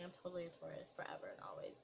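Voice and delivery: whispering. Real, not acted. Playful cheer about her sign.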